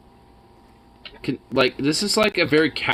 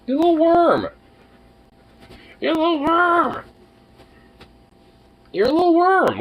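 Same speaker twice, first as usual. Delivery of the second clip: high squeaky pet voice, much higher; 14 semitones higher, 3 dB louder than usual. Real, not acted. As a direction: coo at the cat in a tiny high voice. High baby talk to cat.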